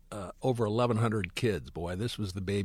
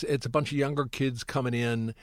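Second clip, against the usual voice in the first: ordinary talk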